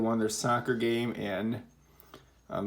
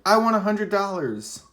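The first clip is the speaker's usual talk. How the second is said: much higher, much louder excited cry; excited exclamation; 10 semitones higher, 9 dB louder than usual. Shown as a demonstration. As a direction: burst out like you just won. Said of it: "Now you're excited".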